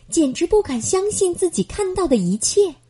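ordinary delivery

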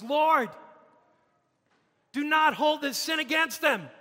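high, loud cry of prayer. Acted. Stephen cries out to the Lord on his knees, loud cry. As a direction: cry it out to God at full voice. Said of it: "he cried out with a loud voice".

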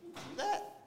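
high, soft mock-incredulous question; softly squeaked retold surprise; retold disbelief. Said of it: "and I was like".

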